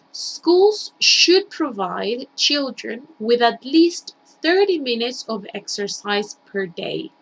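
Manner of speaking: slow, careful classroom read-aloud; slow model reading. Shown as a demonstration. Teacher reading the sentence to students, slow and careful. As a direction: lay out each word slowly for the class.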